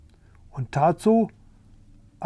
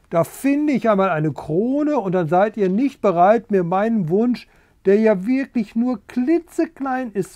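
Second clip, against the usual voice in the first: worked-up, pitched-up complaint; aggrieved pleading; 6 semitones higher than usual. A narrator's reading. Rooster pleading with unwilling pigs, aggrieved. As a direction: protest how small the wish is. Rooster sulks and argues after refusal.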